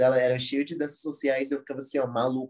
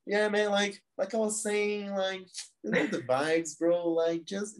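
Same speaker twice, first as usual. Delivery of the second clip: imitating a Detroit guy's laid-back American English, pitched higher; Corey's American slang; 6 semitones higher, slower than usual. Corey chatting with the Brazilian newcomer, imitated American slang voice, higher. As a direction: ham up a cool American bro, higher than your own voice. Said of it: "falava muito desse jeito".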